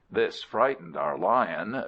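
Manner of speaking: ordinary delivery, nothing marked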